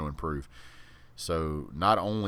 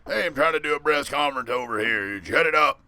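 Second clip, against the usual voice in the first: higher, louder Cajun bark; Coach O Cajun accent; 8 semitones higher, 7 dB louder than usual. Retold or imitated. Coach silencing reporters at a press conference, loud Cajun accent. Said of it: "his accent".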